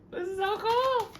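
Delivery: loud, very high, stretched squeal; excited squeal. Real, not acted. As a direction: squeal it out with delight. Delight repeated as loud squeal.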